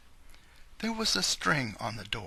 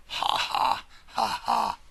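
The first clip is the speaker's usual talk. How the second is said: wicked laugh; 10 semitones higher, 5 dB louder than usual. Acted. Wolf gloats over his plan.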